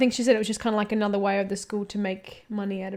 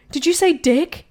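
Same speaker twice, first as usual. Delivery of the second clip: a touch louder, slightly high mock teacher query; mimicked teacher's question; 4 semitones higher, 6 dB louder than usual. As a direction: lightly voice the teacher asking the lads. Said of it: "and she was like".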